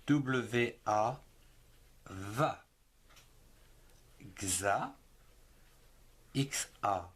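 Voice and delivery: slow, clear classroom reading drill; slow phonics drill. Shown as a demonstration. Teacher drilling young readers on syllables, slow and clear. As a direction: spell each letter, then say the syllable.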